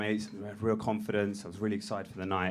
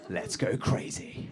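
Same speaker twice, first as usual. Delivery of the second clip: ominous whisper; 2 semitones lower, slower than usual. Acted. Naming the party ominously.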